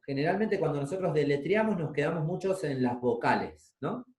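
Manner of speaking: ordinary talk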